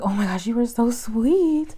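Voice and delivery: high, gushing coo to the dog; gushing sweet talk. Retold or imitated. Greeting the stray dog, a high doting gush. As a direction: gush over her like a baby. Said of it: "I was like".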